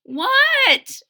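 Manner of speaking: very high, loud questioning cry; acted little girl's loud query; querying child. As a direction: squeak it out as a disbelieving child. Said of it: "Ada queried".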